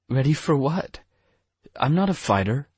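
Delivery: put-on alarmed girl pushing back; Hope's voice. Hope protests, higher character voice.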